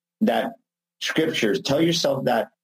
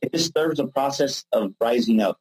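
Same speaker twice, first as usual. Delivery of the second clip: usual speaking voice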